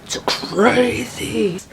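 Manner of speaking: very slow, drawn-out whisper; crazed whisper. Retold or imitated. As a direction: whisper it like someone losing it. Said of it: "But then I went from".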